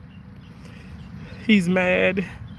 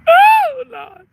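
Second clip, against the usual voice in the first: mock anguish; 11 semitones higher, 8 dB louder than usual. Dramatic outcry before pleas.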